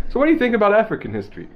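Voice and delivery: high, fast pretend interview question; mock interviewer. Interviewer putting a question to the man doing the voice, mock interviewer. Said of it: "ruin my life in an interview".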